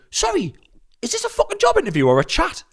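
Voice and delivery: high, incredulous comeback; indignant retort. Acted. Podcaster imagining his retort to a formal question, indignant.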